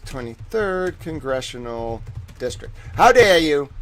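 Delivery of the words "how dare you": loud hammed-up indignation; mock outrage. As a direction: throw the question in mock fury.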